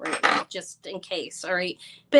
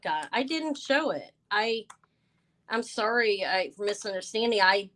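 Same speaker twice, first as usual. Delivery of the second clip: usual speaking voice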